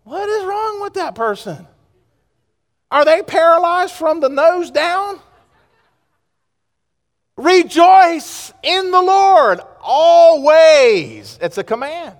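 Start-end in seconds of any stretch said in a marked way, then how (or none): 0.0-5.2 high, incredulous questioning; imitating puzzled onlookers; mock bewilderment. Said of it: "and go". 7.4-11.4 loud, high, very slow and drawn out; full-force preacher's cry; booming proclamation. Real, not acted. Preacher proclaims the verse as command.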